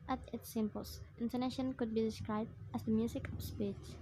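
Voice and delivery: normal talking voice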